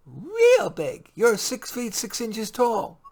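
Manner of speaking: put-on little girl, slow. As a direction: spell it out like a kid boasting. Child Leah voiced.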